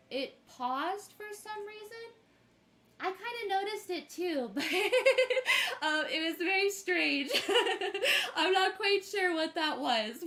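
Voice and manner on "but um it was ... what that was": giggling through the words, a bit louder; laughing. Real, not acted. Streamer laughing to viewers about the glitch, giggly. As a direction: laugh it off while explaining.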